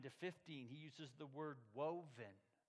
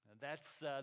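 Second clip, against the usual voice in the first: ordinary talk, nothing marked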